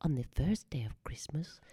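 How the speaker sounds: soft, near-whispered delicate voice; a hint of Audrey Hepburn, hushed; Audrey Hepburn impression. Shown as a demonstration. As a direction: breathe it out very quietly, like Audrey Hepburn singing. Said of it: "I did Audrey Hepburn".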